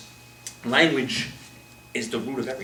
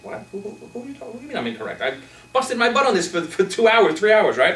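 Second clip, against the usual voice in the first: starts hushed, builds higher and louder into outrage; defensive indignation; 8 semitones higher, 5 dB louder than usual. Retold or imitated. Worker offended at being told he's incorrect. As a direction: protest the hard work he put in. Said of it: "With that attitude".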